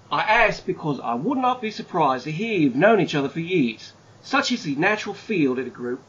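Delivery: hammed-up Aussie bloke reading out a letter; Aussie accent. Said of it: "in my best Aussie accent".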